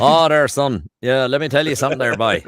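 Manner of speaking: broad Newfie storyteller's accent; Newfoundland accent. Shown as a demonstration. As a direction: spin a yarn like a Newfoundlander. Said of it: "jump the pond over to Newfoundland".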